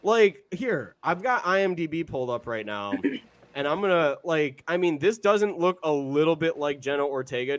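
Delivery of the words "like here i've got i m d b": insistent protest. Real, not acted. Podcaster defends the lookalike claim to co-host, insistent. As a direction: push back, pitched high and slowing down.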